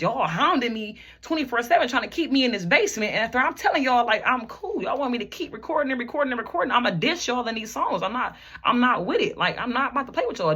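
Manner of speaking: exasperated quarrel, acted out at normal volume; retold fed-up protest.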